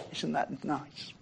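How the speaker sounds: very soft, slow murmur; quiet mock approval. Listeners politely receiving a too-sweet answer, very quietly. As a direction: say it small and underwhelmed. Imagines congregation's underwhelmed, polite response.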